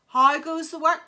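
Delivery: loud bossy farmer call; lazy farmer bellowing from bed. Acted.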